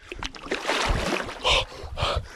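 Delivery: breathless gasp in freezing water; sharp cold gasp. Real, not acted. Plunging into icy water.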